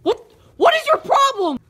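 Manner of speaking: high, loud furious demand; outraged high scream at your brother; angry shout. Acted. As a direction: yell it right in his face. Angry sibling quarrel.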